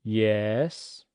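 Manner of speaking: "Yes" falling then rising, tired and low; a fed-up teacher's dipping "Yes"; exasperated "Yes". Shown as a demonstration. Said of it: "exasperated at having to stop".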